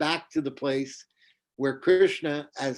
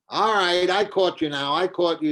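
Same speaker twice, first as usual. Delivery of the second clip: higher and louder, gloating anger; triumphant accusation; 4 semitones higher, 4 dB louder than usual. Acted. Kautilya catching Radharani at home, loud triumphant anger. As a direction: pounce on her with an angry gotcha.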